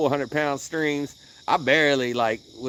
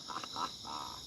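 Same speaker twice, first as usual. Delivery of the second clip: ordinary talk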